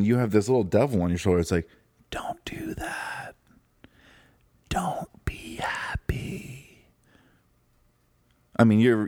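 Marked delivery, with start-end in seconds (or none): 2.1-3.3 slow, soft, unvoiced-sounding devil on the shoulder; creepy devil whisper. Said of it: "your voice might not be as creepy as that". 4.7-6.9 very slow, drawn-out sinister whisper; creepy devil whisper. Acted. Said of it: "your voice might not be as creepy as that".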